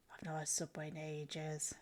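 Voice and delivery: breathy whisper of longing. Pretend. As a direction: whisper it like a secret craving. Confessing love of Subway.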